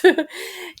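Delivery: squeaky giggle; high giggle. Real, not acted. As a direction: giggle with delight. Laughing after the quote.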